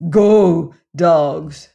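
loud, curt order; commanding shout. A narrator's reading. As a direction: bark the priests out of the room. God dismisses the priests.

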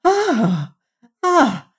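high, delighted cries of "Ah!"; rapturous self-admiring exclamation; wonder and joy. A narrator's reading. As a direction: gasp out each cry in joyful wonder. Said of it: "with wonder and joy".

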